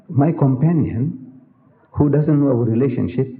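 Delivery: ordinary talk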